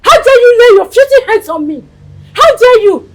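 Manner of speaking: furious shout. Acted. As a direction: blast him with outrage. Wife confronts husband angrily.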